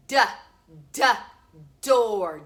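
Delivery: "D, D, door" with a hard repeated D, door loud; pron. Shown as a demonstration. Teacher drilling the D of "door" for the class. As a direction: sound out the D in "door". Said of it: "teacher sounds out initial D".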